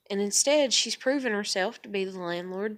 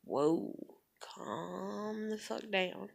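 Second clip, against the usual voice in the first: hushed, calming; 9 dB quieter, much slower than usual. Retold or imitated. Retelling her reaction to a rant, hushed and slow. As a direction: talk someone down slowly and quietly.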